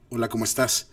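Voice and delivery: fuller, well-placed projected voice. Shown as a demonstration. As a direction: place the voice and let it carry. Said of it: "con la voz más colocada".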